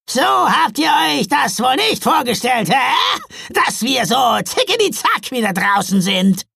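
high, loud troll jeering; cheeky troll son. A narrator's reading. Troll taunting the Santas outside again, cheeky and loud. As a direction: crow it out like a brash troll.

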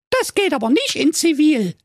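put-on very high, squeaky girl voice; cheeky young girl.

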